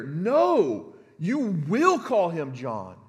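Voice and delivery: loud No, then slow, firm command. Retold or imitated. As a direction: lay down the law, word by word. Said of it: "It has an imperatival voice".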